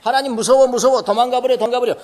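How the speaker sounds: high, loud frightened cry; mock fear. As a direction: act out running from a scary God. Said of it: "주로 이제 이렇게 생각하잖아요". (겁에 질린 과장된 외침)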